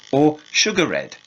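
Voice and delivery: "sugar red" with the final E sounded, three beats; three-syllable sugared. Shown as a demonstration. Teacher showing old pronunciation with a sounded ending. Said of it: "three syllables".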